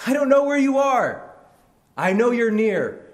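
high, loud searching shout; crying out. Acted. Blind man calls to unseen Jesus, crying out. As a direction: shout into the distance, high and sustained. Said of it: "he cries out all the more".

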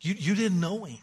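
put-on calm the boy down gently; reassuring dad. Dad comforting his son about the truck, softly. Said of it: "Dad comes along and says".

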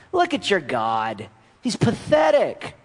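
mock the defeated people's God; a scornful foreign voice jeering; mocking nations.

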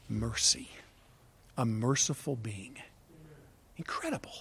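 suddenly soft, ending in a whisper; hushed awe. Real, not acted. Preacher answering what we see in God, hushed awe. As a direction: drop to a reverent hush, pausing between phrases. Awed answer after loud build.

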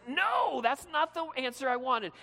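high, loud petulant protest; mock tantrum. Rich young ruler refusing Jesus's answer, mock tantrum. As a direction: act out an indignant, childish refusal. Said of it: "He has this temper tantrum".

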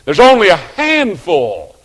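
full-force pulpit delivery; preaching at full force. Acted. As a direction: preach the discouragement out to the pews. Sermon climax.

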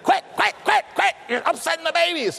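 very high, shrill-pitched mock scolding; scolding women. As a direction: nag them to stop like fussing aunts.

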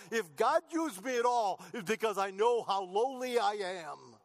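climbing preacher's cry; rising preaching fervor. Real, not acted. Preacher builds to a peak.

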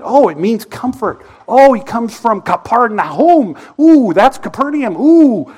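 hammed-up delight at a distraction; mock excitement. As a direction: gush over a minor detail to dodge the point. Preacher mocks his own dodging.

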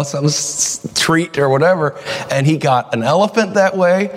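ordinary delivery

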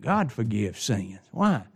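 high, loud pulpit preaching; full-force preaching. Acted. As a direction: preach it out to the congregation.

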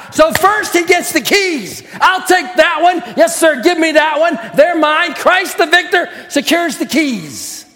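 ringing, fervent pulpit preaching; preaching at full force. Acted. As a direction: proclaim it to the whole church with fire. Preaching to the congregation.

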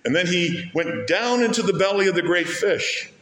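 preacher hammering the word down; stress on down. Real, not acted. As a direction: punch down, then let the line fall. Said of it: "That word down is repeated a lot".

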